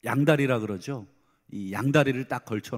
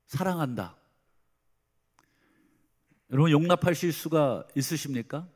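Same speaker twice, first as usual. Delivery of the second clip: normal talking voice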